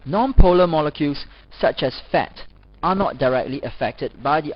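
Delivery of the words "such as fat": normal talking voice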